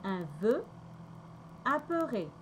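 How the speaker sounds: "un vœu, apeuré" with rounded French vowels; teacher modelling "un vœu, apeuré" for learners; French pronunciation demo. Shown as a demonstration.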